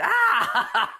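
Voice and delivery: high laughing mock scream; a playful shrieking raptor. Dad doing the raptor's scream with a laugh. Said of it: "the velociraptor screamed".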